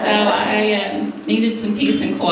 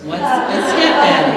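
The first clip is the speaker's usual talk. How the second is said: put-on higher and louder, a small child all ears; eager little boy; 6 semitones higher, 6 dB louder than usual. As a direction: ask it like an eager toddler. Child asks eager question.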